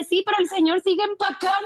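high-pitched, breaking into laughter; retold complaint through laughter. Señora complains that grandfather keeps packing, laughing retold complaint. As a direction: imitate the complaint while starting to laugh.

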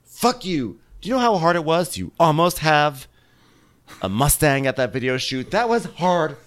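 high, indignant rant; going off. Retold or imitated. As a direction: go off on them, hitting key words hard. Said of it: "Candace is still going off".

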